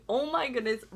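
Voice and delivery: ordinary talk, nothing marked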